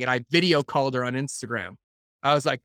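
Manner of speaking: ordinary talk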